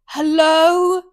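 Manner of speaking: high, loud startled hello. Retold or imitated. Waking to a face hovering, startled hello. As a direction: call out in the dark.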